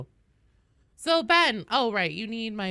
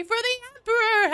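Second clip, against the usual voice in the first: mock battle cry; 7 dB louder than usual. Streamer cheering a Christmas tree decorated for the Emperor, mock battle cry. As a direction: holler it like charging into battle.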